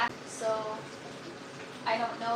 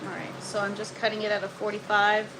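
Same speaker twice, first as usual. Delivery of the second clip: usual speaking voice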